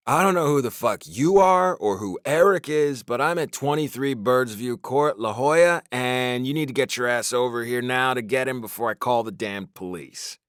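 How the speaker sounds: loud, high, angry demand; father demanding the friend collect the drunk; angry phone rant. A narrator's reading. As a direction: chew him out over the phone. Angry call to stranger's friend.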